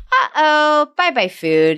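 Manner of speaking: uh-oh sung high, then calm; light playful toddler talk; singsong parent voice. Shown as a demonstration. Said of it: "You go".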